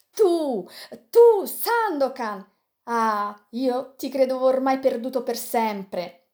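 high, overjoyed cry of recognition; joyful exclamation. Acted. Yanez greeting his lost brother, overjoyed cry. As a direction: burst out, stunned with joy.

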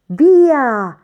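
loud, high shout to start a race; one drawn-out, high-pitched yell; starter's shout. A narrator's reading. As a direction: shout it out to launch the runners. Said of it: "gridò il mossiere".

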